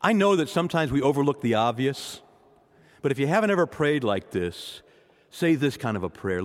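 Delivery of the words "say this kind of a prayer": normal talking voice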